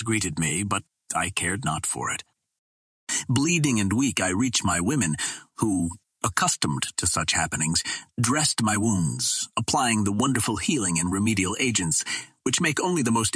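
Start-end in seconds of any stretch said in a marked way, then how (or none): none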